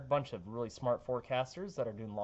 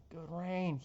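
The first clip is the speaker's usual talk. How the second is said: normal talking voice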